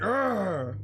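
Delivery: frustrated alien "argh" yell; disgruntled growl. Acted. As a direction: snarl it out in disgust. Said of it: "a disgruntled face".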